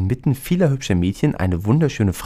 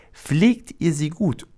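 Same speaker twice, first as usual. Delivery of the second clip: slow fairy voice; 8 semitones higher, slower than usual. A narrator's reading. Fairy queen's advice.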